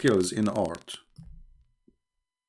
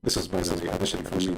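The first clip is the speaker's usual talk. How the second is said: usual speaking voice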